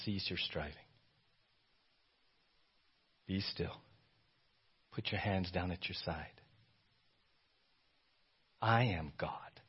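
very soft, low, firm command; firm but hushed. Acted. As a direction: speak it firmly but nearly under breath. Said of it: "firmly saying".

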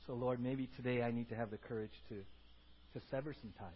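low, subdued, halting prayer; humble, quiet address to God; congregational prayer. Real, not acted. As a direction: pray earnestly and softly, pausing between phrases. Leads closing prayer.

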